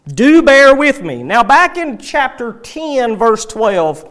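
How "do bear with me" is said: louder and higher, leaning hard on DO; emphatic plea. Shown as a demonstration. Preacher repeating Paul's plea to the congregation, emphatic and loud. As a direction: press the plea home with force. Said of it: "So he begins here and he says".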